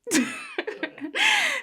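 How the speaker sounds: high-pitched burst of laughter; giggle. Real, not acted. Podcaster amused at her own words, high giggle. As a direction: crack up at yourself.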